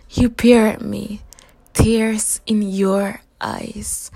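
ordinary delivery, nothing marked

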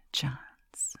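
"chance" drawn out as a soft, stressed whisper. Shown as a demonstration. Miss teasing her pet, whispered stress on "chance". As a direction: lean into "chance", breathy and slow. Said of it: "And I stress the word".

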